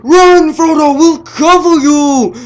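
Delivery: high loud hammed-up battle cry; mock heroic shout. Hobbits shouting to Frodo during the orc attack, hammed-up high yell. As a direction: yell it like brave hobbits covering a friend. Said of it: "Merry and Pippin are like".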